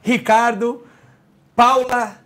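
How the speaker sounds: building showman announcement, names called out; grand entrance announcement. Acted. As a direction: hype the guests' entrance, rising. Host announces guests with applause. (anúncio de entrada triunfal)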